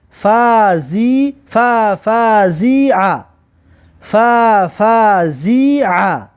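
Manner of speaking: very slow, drawn-out syllables; slow teacher's model reading. Shown as a demonstration. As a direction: stretch each syllable for learners to copy. Teacher models the blended word.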